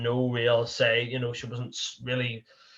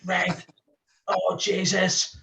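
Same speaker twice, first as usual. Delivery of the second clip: higher, drawn-out exasperated groan, imitating himself; 8 semitones higher, much slower than usual. Driver finding the car park reserved, higher exasperated groan. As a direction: let it sag slowly into disbelief. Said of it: "And I'm like".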